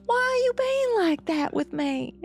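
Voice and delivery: high-pitched, teasing Southern belle; thick Southern accent. Acted. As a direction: pout in an exaggerated Southern accent. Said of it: "has the thickest little accent ever".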